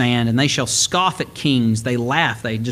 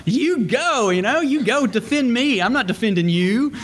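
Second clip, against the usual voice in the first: scolding the dog in a high playful voice; high, teasing scold at a cowardly dog; high mock scolding; 7 semitones higher than usual. Preacher scolding his cowardly dog, high mock scold. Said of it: "scolding the cowardly dog".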